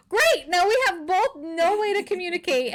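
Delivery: high-pitched mock exasperation; exasperated. Stranded woman to the man she's locked in with, mock exasperated.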